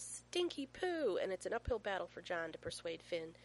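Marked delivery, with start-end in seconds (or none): 0.0-1.2 high, slow, drawn-out holler; child's yell. A narrator's reading. Small son at candle shop doorway, gleeful yell. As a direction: yell it high and long like a toddler. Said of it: "throws back his head and yells".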